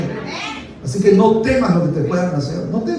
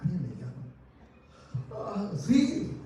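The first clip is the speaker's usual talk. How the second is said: quiet musing; 15 dB quieter than usual. Acted. Preacher as Daniel resting among lions, quiet musing. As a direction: murmur it softly to yourself. Acts Daniel hearing the call.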